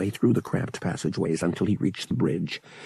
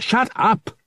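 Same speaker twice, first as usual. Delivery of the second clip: high, exasperated shout; bellowing; 13 semitones higher, 4 dB louder than usual. A narrator's reading. Pilot silences the droid, bellowing. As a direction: cut in with an angry yell. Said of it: "Han bellowed".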